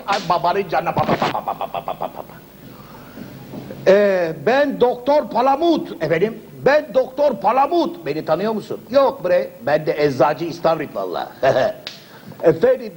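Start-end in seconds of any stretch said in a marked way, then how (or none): 3.9-8.1 lightly voiced proud foreign doctor; hint of pompous doctor. Doktor Palamut introducing himself to the patient, grandly. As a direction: announce yourself grandly.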